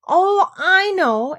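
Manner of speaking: high, loud and slow; eager exclamation. Acted. Alice to Duchess, high eager exclaim. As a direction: burst out with sudden eagerness. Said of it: "exclaimed Alice".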